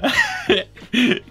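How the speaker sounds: rire très aigu; éclat de rire. Real, not acted. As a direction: pars dans un rire haut perché. Rit en racontant la question. (éclat de rire aigu)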